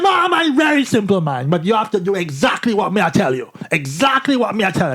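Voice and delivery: mock Jamaican guide laying down terms; Jamaican accent. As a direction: instruct firmly as an imitated Jamaican Rasta. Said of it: "really thick Jamaican accent".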